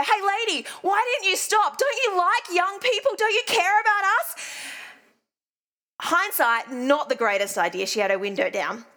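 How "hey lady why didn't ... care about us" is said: high calling-out yell, pitched well up; kid yelling. Retold or imitated. Retelling yelling at the lady driving off, high kid's yell. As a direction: holler after a driver like a cheeky kid. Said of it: "yell out to her".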